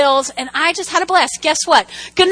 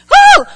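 high, loud "woo"; sudden piercing cheer; joyful whoop; 11 dB louder than usual. Real, not acted. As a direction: whoop it out after the big line. Whoop after climax.

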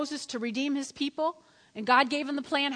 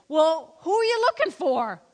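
bold questioning; 4 semitones higher, 7 dB louder than usual. Acted. Jesus voiced questioning soldiers.

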